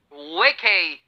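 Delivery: वेके said as "way-kay", clear two syllables; teacher modelling वेके; model pronunciation. Shown as a demonstration. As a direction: pronounce वेके like vay-kay. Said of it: "क्या कहा मैंने?".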